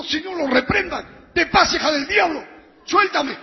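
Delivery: high, loud protest while retelling; alarmed rebuke. Retold or imitated. Preacher fends off girls grabbing him, alarmed shouted rebuke. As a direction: shout the rebuke to fend them off.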